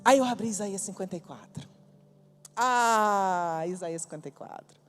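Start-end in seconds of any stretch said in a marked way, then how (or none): none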